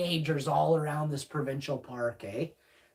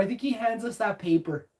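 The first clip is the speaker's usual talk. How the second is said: ordinary talk, nothing marked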